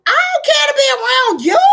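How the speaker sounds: loud, high outburst at family; defiant shout. Real, not acted. Telling off unwelcome family members.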